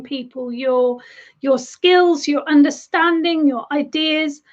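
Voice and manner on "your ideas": ordinary delivery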